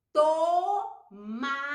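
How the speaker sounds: Tomate slowly split into held syllables. Shown as a demonstration. Teacher saying it again for children, syllable by syllable. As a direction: separate to, ma and te in Tomate. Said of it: "Vamos falar de novo".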